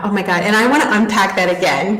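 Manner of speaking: ordinary delivery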